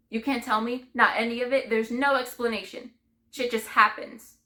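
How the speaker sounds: loud, grieving accusation; bitter anger. Acted. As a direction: throw it out loudly and bitterly. Bitterly rejects any explanation.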